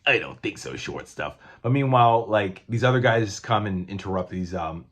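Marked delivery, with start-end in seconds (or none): 0.0-1.4 lower, dismissive brute; lightly voiced Thing brushing him off; low mock refusal. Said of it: "And Thing's like".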